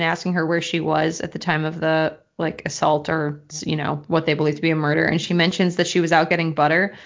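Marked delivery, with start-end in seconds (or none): none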